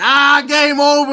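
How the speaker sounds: high, loud, slow gloat; mocking rival fan. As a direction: gloat like the other team's fan. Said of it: "Eagle fans talking".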